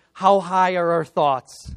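loud, punchy motivational question; rallying call. Retold or imitated. Father quizzes daughter in rallying chant.

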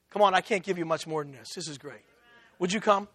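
ordinary talk, nothing marked

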